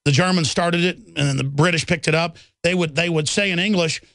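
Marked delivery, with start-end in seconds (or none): none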